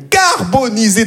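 carbonisé said slowly, hammed up; drawn-out CARBONISÉ. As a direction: stretch out carbonisé in mock horror. Exaggerating shocking cigarette-pack image.

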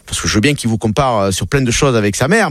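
ordinary talk

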